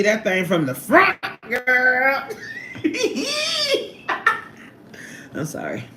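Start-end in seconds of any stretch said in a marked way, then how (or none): 2.3-4.5 screaming cackle; helpless high laughter; high shrieking laugh. Real, not acted. Cracking up at own joke.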